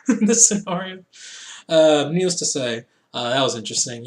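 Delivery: ordinary delivery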